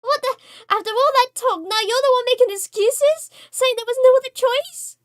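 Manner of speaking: high, loud indignant shouting; angry outburst. Acted. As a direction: tear into them for the excuses. Voicing angry character quarrel.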